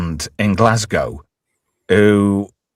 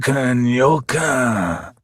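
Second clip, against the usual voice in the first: slow, drawling Scottish comic character; Dee Dee; 4 dB quieter, much slower than usual. Retold or imitated. Host quotes a Limmy show character, drawling Scottish impression. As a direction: imitate a spaced-out Scottish sketch character, words stretched out. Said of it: "I was doing Dee Dee".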